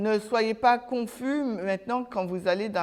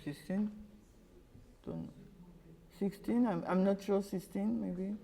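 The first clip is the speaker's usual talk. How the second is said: hushed, hesitant guessing; barely voiced, not sure of the answer; unsure whisper; 13 dB quieter than usual. Real, not acted.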